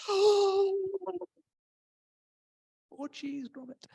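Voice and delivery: high gasped mock alarm; hammed-up alarm, fading off; mock panic gasp. Shown as a demonstration. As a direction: play a cartoonish fright. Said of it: "it doesn't feel like one of those moments".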